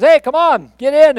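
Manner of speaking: high, loud call across floodwater; a rescuer hollering from a second boat; shouting from a boat. Retold or imitated. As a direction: yell at the man on the roof to climb in.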